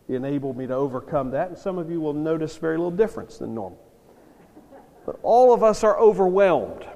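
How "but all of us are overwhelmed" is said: louder and higher, stressing all; emphatic overwhelmed. Real, not acted. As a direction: lean hard on "all" to stir the room. Preacher stresses shared awe.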